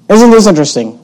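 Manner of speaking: normal talking voice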